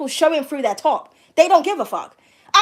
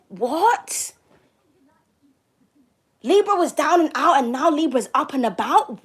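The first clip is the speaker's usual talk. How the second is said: mock-incredulous questioning; crazy people's disbelief. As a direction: act baffled, each "What?" sweeping upward. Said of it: "channeling what these crazy people are saying".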